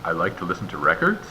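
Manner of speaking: questioning rise at the end of "records"; rising question intonation. Shown as a demonstration. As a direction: pitch climbs on "records" as if asking. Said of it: "rising intonation for a question".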